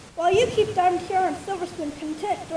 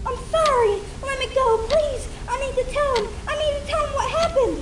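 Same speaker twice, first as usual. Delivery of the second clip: high pleading cry, raised; a trapped filly begging to be let go; desperate shouting; 8 semitones higher than usual. A narrator's reading. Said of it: "continued to shout".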